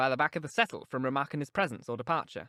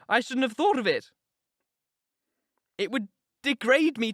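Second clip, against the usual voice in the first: put-on higher, urgent young woman confiding; Catherine, passionate; 8 semitones higher, 6 dB louder than usual. A narrator's reading. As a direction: pour out her heart to Nelly, raised voice. Catherine voiced in reading.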